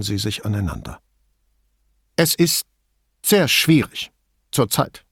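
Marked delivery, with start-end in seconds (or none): 2.2-5.0 higher woman's voice, guarded; terse middle-aged woman, wary; Signora Toso voice. A narrator's reading. Toso speaks.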